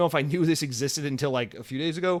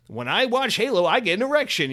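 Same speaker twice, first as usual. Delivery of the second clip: higher, louder goofy voice; silly title voice; 8 semitones higher, 5 dB louder than usual. Retold or imitated. Podcaster contrasting a crude joke title, goofy higher voice. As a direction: play it as a dumb joke title.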